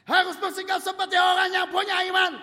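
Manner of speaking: loud, high preaching; preaching at full force. Real, not acted. Preacher urges the congregation, loud and high. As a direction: drive the point home.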